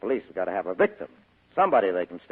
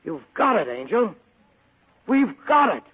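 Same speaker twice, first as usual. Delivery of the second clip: much higher and louder, slowing on the name; triumphant excitement; 12 semitones higher, 7 dB louder, slower than usual. Acted. Spade revealing the Falcon to his secretary, thrilled. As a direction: savor the prize as you reveal it.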